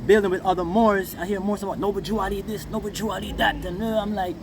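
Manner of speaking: usual speaking voice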